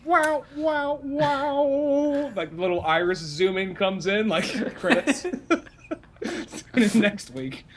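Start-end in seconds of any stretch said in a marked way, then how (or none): 0.0-2.3 high sung wow; sing-song wow. Retold or imitated. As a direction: croon it out dazed. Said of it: "just going".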